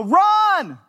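high loud mock yell. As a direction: shout it like the crowd. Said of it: "everybody's gonna yell".

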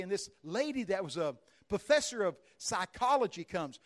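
usual speaking voice

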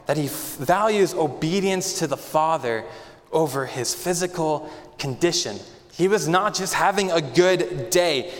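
usual speaking voice

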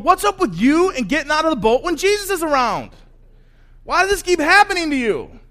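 high, loud protest; exasperated shouting. Acted. Disciples in the boat to their reckless friend, exasperated yell. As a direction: pester him at full volume, fed up.

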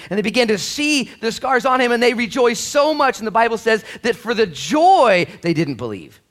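full-force preaching. Real, not acted. Pastor rousing the church, full-force preaching. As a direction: lift the room with it.

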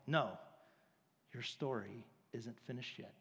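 low, soft, measured answer; gentle refusal. Acted. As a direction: turn them down quietly and gently. God gently answers an impatient believer.

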